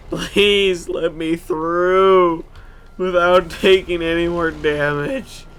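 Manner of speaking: stretching every word as a desperate plea; slow pleading. Real, not acted. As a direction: beg the road to let you pass, very slowly. Pleading with the game.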